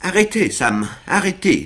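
high, urgent plea to stop; panicked Winkle. A narrator's reading. Winkle clinging to Sam, trembling and panicked. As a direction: beg him to hold on. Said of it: "agité d'un violent tremblement".

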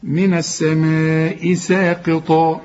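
slow, with madd and a light seen in ساقطا; connected tajweed recitation. Shown as a demonstration. Teacher joining the words after the drill. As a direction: join the words slowly in recitation. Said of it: "وبعدين اوصل بقى".

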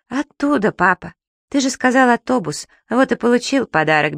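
ordinary delivery

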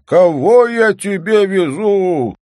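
slow, high, loud holler; drawn-out excited call; calling from afar. A narrator's reading. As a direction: shout it out across a distance. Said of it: "закричал Лежнев издали своей жене".

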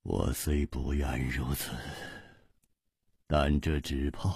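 低沉轻声，缓慢而无奈; 停顿着低语，末句渐渐扬起. A narrator's reading. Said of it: "楚留香黯然说". (沉痛地低声道来)

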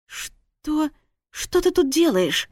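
dazed, incredulous reaction; startled question. Acted. Hungover wife finds husband beside her, startled.

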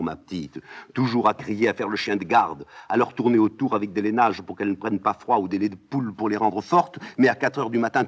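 put-on old nurse loudly teasing and scolding; mocking scolding nurse. Nurse lament.

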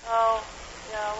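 ordinary talk, nothing marked

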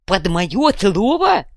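loud, slow, drawn-out indignation; indignant. A narrator's reading. Fuhrer hears of the loan request, indignant. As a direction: stretch it out in outraged disbelief. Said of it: "возмутился фюрер".

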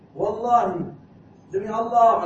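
loud, high, emphatic oath; loud sworn oath. Acted. Angel of death swearing before grieving families, loudly. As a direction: declare it like a vow.

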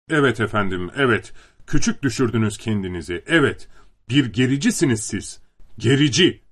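ordinary delivery

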